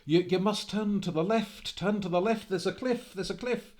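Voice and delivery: high, loud panicked plea; a man crying out to a rider heading for the cliff; panicked warning. Acted. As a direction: shout the warning as fear rises. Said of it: "he began to panic and said".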